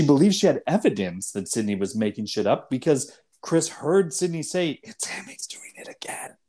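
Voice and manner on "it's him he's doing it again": hushed unvoiced whisper. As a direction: breathe it like a secret. Retold overheard phone line.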